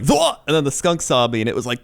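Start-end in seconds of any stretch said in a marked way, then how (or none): 0.0-0.4 sudden high startled yelp, much higher. Retold or imitated. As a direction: jump back with a yelp. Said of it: "and I was like".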